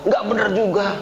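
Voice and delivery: normal talking voice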